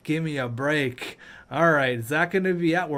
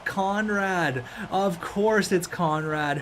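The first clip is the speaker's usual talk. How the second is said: usual speaking voice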